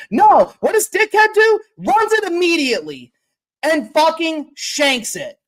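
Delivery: a fan venting about a rushed kick in real exasperation; exasperated outburst. Real, not acted. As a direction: blow up at the coach's blunder. Furious fan retelling the kick.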